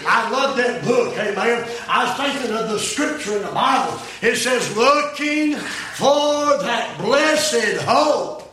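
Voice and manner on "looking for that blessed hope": loud, raised preacher's delivery with shouted peaks; preaching at full force. Acted. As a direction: warn the congregation the flood is coming. Preaching about Noah and the flood.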